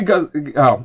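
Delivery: ordinary talk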